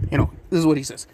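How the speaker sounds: ordinary talk, nothing marked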